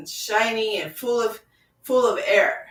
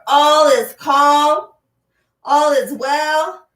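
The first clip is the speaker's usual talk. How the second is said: louder, higher and slow drawn-out affirmation; 5 semitones higher, 9 dB louder, much slower than usual. Shown as a demonstration. Teacher urges the class to say the affirmation louder, slow and loud. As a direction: call it out slow and loud, lifting the pitch. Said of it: "Say it louder".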